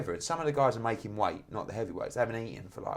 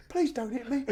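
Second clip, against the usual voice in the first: very high, timid begging voice; mock fearful plea; 14 semitones higher than usual. Promoter facing a furious boxer, high mock plea. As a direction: squeak it like you dread a punch.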